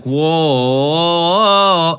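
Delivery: imitated whoa. Co-host imitating the Havel flip video, drawn-out loud whoa. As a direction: draw out a big mimicked whoa. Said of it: "it would be like".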